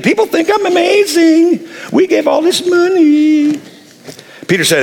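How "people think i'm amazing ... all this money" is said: high, showy self-satisfied voice with a laugh; smug wife. Acted. Preacher voicing the proud wife, high and smug. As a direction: preen about the gift. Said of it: "she's still thinking".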